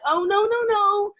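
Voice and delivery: high, prim no-thank-you; polite refusal. Retold or imitated. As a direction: decline him sweetly but firmly. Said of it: "I'm like".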